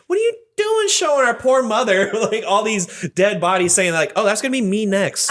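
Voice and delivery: light exasperated telling-off; mock scolding. Scolding brother in retold story.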